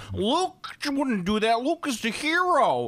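high, slow, whiny mock fanboy; mock an indignant fan, stretched out and high; complaining fans. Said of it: "they're legit complaining".

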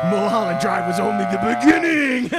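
loud, far-off booming declaration; mock ominous proclamation. Answering the stunned viewer in a bit, loud mock-ominous boom. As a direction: boom it out like a mad announcer.

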